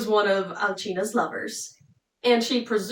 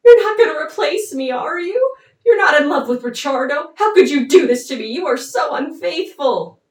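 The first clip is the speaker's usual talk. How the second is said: loud, higher-pitched mock hurt; hammed-up jilted lover demanding answers; upset accusation; 5 semitones higher, 7 dB louder than usual. Jealous lover confronts Alcina over Ricciardo, loud upset accusation.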